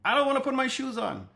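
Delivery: mock kid voice refusing shoes; whiny child. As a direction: whine it like a stubborn little kid. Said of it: "when your child is like".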